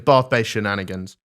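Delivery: ordinary talk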